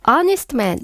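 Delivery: "Honest" with the H left silent. Shown as a demonstration. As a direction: start "Honest" straight on the vowel, like "on-ist". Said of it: "마찬가지로 H 발음 나지 않아서요".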